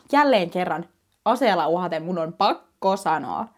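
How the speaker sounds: ordinary delivery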